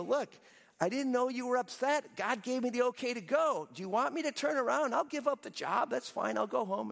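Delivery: high-pitched, put-upon excuse; defensive protest. Retold or imitated. Balaam to the angry angel, offering to quit, defensive. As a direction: plead your case in mock exasperation.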